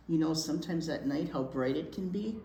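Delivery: ordinary delivery, nothing marked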